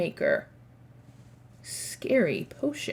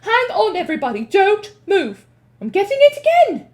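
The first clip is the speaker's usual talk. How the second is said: urgent witch; 11 semitones higher, 9 dB louder than usual. Acted. Mildred sniffs urgently.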